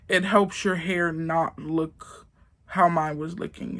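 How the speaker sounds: ordinary delivery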